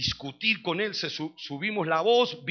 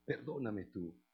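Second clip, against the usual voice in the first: llanto imitado; 2 semitones lower, 12 dB quieter, much slower than usual. Retold or imitated. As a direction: imitate a weeping father begging forgiveness. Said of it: "se pone a llorar y dice". (imitación de padre lloroso que suplica bajito)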